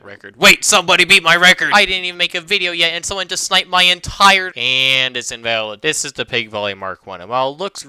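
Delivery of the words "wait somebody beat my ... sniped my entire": loud, high-pitched protest; high, loud, rushed complaint; outraged disbelief. Acted. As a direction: blurt it out, indignant at being beaten. Acts out shock at beaten record.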